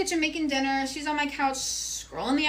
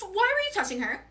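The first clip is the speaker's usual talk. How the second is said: angry accusation; 8 semitones higher than usual. Retold or imitated. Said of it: "And I'm like".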